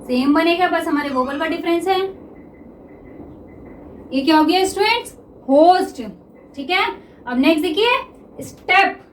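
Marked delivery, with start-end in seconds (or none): none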